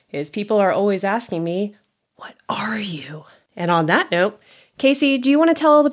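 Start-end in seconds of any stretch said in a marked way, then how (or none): 2.2-3.2 slow, hushed whisper of the nosy question; whispered quote. Retold or imitated. People's nosy question, mock whispered. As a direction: whisper it like a strange stranger. People asking about her heritage.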